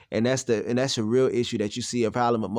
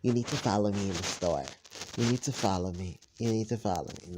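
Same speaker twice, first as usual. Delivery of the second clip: lower, quieter mocking mother voice; 3 semitones lower, 6 dB quieter than usual. Son mocks his mother's constant demand. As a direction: mimic her bossy nagging. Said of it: "she always had this thing like".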